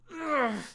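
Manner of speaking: high pained groan; acted high grunt of pain. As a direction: groan as the second shot lands. Shot again in the side.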